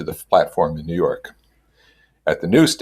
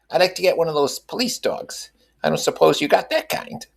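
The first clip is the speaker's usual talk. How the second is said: high, eager Mrs. Wilson voice; earnest; 11 semitones higher than usual. A narrator's reading. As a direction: pitch it up and plead sincerely.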